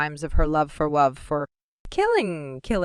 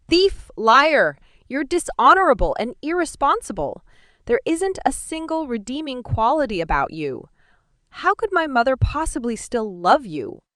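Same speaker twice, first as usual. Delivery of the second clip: higher, louder angry accusations; furious outburst; 8 semitones higher, 4 dB louder than usual. Acted. Daughter screams insults at her sorcerer father, furious. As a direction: hurl the insults at him.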